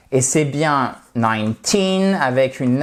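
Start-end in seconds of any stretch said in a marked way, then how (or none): 1.1-2.2 put-on "nineteen" with the stress on TEEN; stress on second syllable. Shown as a demonstration. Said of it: "accentuation sur la seconde syllabe". (« nineteen » accentué sur la seconde syllabe)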